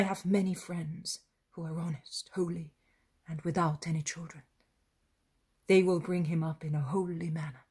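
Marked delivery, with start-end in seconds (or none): none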